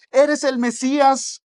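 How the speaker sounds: high, slow, drawn-out proclamation; proclaiming shout. Retold or imitated. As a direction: cry it out to the passing king. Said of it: "y gritaba".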